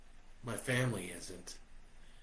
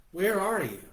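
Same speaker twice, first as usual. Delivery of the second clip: higher, louder woman's voice; Josie's voice; 9 semitones higher, 7 dB louder than usual. A narrator's reading. Josie asking where he is, higher and louder. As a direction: ask eagerly as an older woman.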